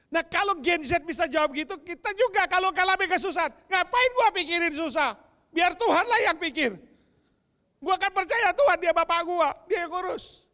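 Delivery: loud, high preacher's cry; fiery sermon delivery; preaching. Acted. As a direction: proclaim it to the whole hall. Preaching at full force.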